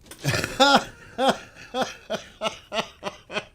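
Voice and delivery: real burst of loud, high laughter; laughing out loud at a silly question. Real, not acted. Cracking up at own question.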